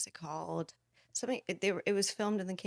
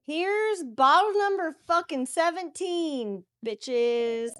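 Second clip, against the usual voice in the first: hype announcement; 4 semitones higher, 6 dB louder, much slower than usual. Real, not acted. Popping champagne, announcing.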